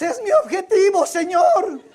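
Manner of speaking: high, loud, playful outcry to God; hammed-up pleading shout; mock fervent cry. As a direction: cry it out like an overeager believer, in jest. Mock congregant cries out for the goal.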